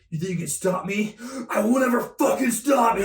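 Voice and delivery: building to a high, loud anime yell; rising from menace to full dub scream; overacted Eren anime dub. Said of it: "And he goes like".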